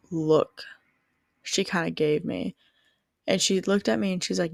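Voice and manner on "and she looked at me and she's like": ordinary talk, nothing marked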